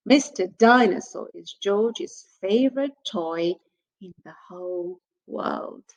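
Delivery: ordinary delivery, nothing marked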